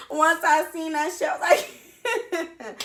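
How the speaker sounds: high, helpless laughter through words; crying laughing. Real, not acted. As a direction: laugh till you can barely talk. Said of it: "I started crying, laughing".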